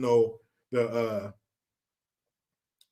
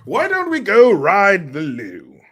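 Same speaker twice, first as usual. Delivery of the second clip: put-on loud, high, drawn-out British accent; hammed-up British invitation; mock British accent; 4 semitones higher, 8 dB louder, slower than usual. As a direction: call it out slowly in fake British. Playing with British slang.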